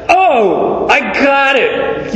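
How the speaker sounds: light-bulb moment, eureka; sudden idea. Acted. Preacher acting the steward's light-bulb moment with excitement. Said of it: "the little light bulb".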